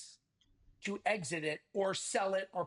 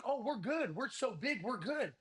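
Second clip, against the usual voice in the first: higher-pitched, bobbing mock overconfidence; breezy, higher-pitched self-assurance, put on; mock complacency; 5 semitones higher than usual. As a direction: imitate owners sure they're untouchable. Mimicking complacent business owners.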